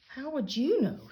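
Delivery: indignant retort. A narrator's reading. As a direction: toss it back with attitude. D W snaps back at Arthur.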